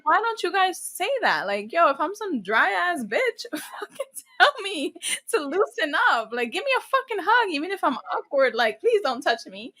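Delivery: high-pitched comic exasperation; exasperated retelling. Retold or imitated. Telling friends to loosen her up, playfully exasperated.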